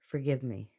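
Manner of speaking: low, soft voice after crying; tearful. Real, not acted. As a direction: apologize quietly, holding back real tears. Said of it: "I apologize for the crying".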